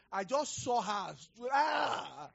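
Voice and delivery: high startled yelp; mock-horrified shout at what he saw; shocked cry.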